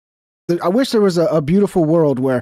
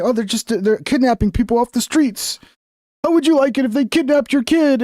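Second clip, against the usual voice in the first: higher, whiny parody of critics; mocking protester voice; 7 semitones higher than usual. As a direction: imitate them as overdramatic. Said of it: "they're just saying".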